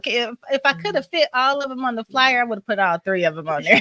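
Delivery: ordinary talk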